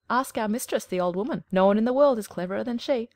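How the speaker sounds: hen lecturing with puffed pride. A narrator's reading.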